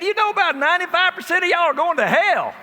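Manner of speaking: retold preacher booming a warning; loud preaching. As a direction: deliver the shock line at full preacher volume. Phil preaching at Ducks Unlimited.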